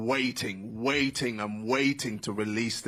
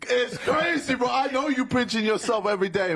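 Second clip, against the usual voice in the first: excited hype; 12 semitones higher, 3 dB louder, faster than usual. Real, not acted. Hyping fighter on stage.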